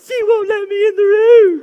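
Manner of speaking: high, loud, mock-tearful little boy wail; whiny crying kid. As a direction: whimper it like a wronged five-year-old. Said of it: "she's like".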